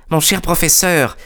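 put-on high-pitched young collaborator's plea; exclamation de Bennett. A narrator's reading. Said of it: "s'exclama-t-il". (voix aiguë de collaborateur qui intervient)

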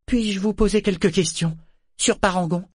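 put-on high-pitched girl asking politely; young Malta, eager. A narrator's reading. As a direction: play the daughter, voice lifted well up.

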